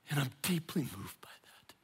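hushed, whispered confession; moved whisper. Real, not acted. Preacher whispering to his congregation, moved.

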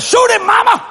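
high-pitched, excited yell; egging on. Retold or imitated. Five-year-old urges mama to shoot, egging on. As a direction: imitate a kid yelling for blood.

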